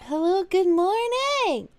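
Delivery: high, slow and drawn-out hello; sing-song greeting. Acted. Streamer greets chat with a high, slow sing-song hello. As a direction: stretch the greeting out like a cheerful host.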